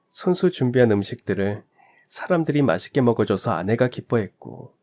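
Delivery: ordinary delivery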